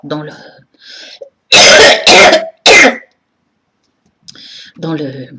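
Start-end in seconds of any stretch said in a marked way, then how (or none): 1.5-3.0 loud, harsh real cough; hard coughing fit. Real, not acted. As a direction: cough hard, breaking off mid-speech. Ill, coughing fit while talking.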